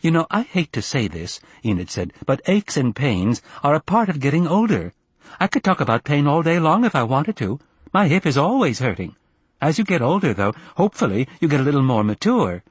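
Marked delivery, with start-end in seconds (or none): 0.0-1.4 higher-pitched, lightly voiced mother; disapproving mother. Enid, disapproving mother on phone. 2.3-12.6 higher-pitched, lecturing mother; self-righteous mother on the phone; disapproving mother. Acted.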